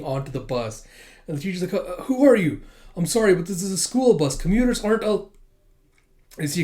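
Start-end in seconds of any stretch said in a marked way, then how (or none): 1.7-5.3 higher, louder alarmed question; startled confrontation. Acted. Teacher stopping strangers boarding the school bus, startled. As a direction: challenge the strangers nervously.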